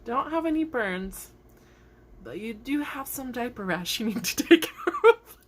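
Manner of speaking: high voice breaking into laughter; boss laughing. Retold or imitated. Boss checking the burn, high laughing verdict. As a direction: deliver the verdict, cracking up. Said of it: "she goes".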